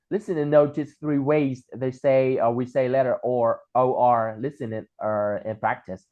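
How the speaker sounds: slow, careful textbook reading; reading the book instructions. Shown as a demonstration. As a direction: read the exercise aloud deliberately. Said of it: "Ở đây thì mình sẽ thấy là".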